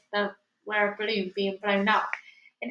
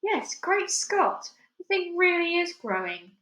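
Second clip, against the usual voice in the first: amazed; 8 semitones higher than usual. Acted. Amazed realization.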